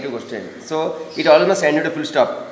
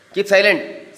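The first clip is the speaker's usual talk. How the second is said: sharp order, higher; command; 6 semitones higher, slower than usual. Shown as a demonstration. Teacher demonstrates a command sentence as an order. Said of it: "So see a command".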